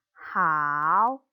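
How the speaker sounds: hao drawn out slowly, dipping down then rising; third tone hao. Shown as a demonstration. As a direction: swing hao down and back up. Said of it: "yang diayun".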